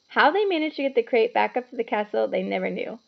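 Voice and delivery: ordinary delivery, nothing marked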